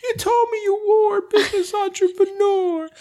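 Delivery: very high, thin woman's voice; high squeaky voice. Acted. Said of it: "you cannot write properly".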